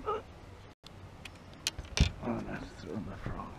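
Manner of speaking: ordinary delivery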